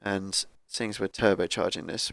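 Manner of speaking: ordinary talk, nothing marked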